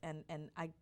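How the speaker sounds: ordinary talk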